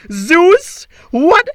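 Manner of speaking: high, loud, slow anguished wail; wailing to the sky. Acted. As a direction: howl it at the heavens. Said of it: "wailed to the cruel sky".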